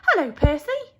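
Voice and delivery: high, loud cheerful mice greeting; tiny mice calling out happily. Acted. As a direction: call out like excited little mice. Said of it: "they called".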